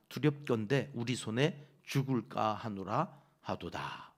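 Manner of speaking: low, steady reading aloud of scripture. Acted. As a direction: read the verse together, measured.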